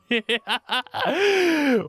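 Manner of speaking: derisive villain cackle; high, gloating laughter that falls away; mocking laugh. Bully laughing at the puppy, gloating cackle.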